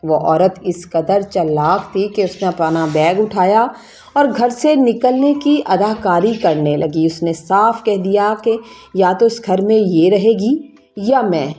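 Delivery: ordinary delivery, nothing marked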